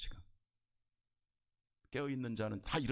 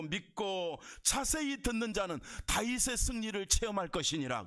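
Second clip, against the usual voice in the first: pregação a plena força; 10 semitones higher than usual. Real, not acted. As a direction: proclaim the promise in rising, driving phrases.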